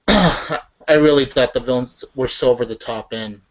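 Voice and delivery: ordinary talk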